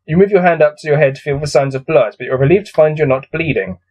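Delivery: ordinary talk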